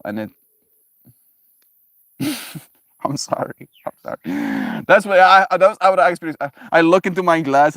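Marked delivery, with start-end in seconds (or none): 2.2-4.8 laughing apology. Real, not acted. Laughing apology after gross image. 4.9-7.7 louder, fast, worked-up explaining; excited rush of words; excited outburst. Real, not acted. Excited explaining the shared disgust.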